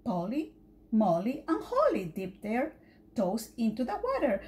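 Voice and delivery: ordinary delivery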